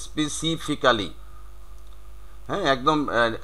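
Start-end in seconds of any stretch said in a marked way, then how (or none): 0.1-1.1 "specifically" as spesi-fi-KAA-li; every written syllable of "specifically" sounded; local "specifically". Shown as a demonstration. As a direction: say "specifically" with a full "kaa" before "li". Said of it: "আমরা কিন্তু এটাই বলে থাকি".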